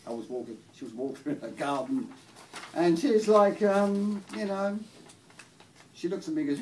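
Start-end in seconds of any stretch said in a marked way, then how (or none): none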